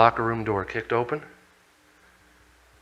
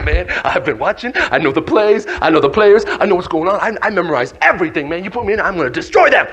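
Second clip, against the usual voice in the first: raised, loud, eager boasting; hyper; 5 semitones higher, 6 dB louder than usual. Acted. Eager player begging coach to put him in, hyper. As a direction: pump yourself up as you plead. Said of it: "You're a little hyper".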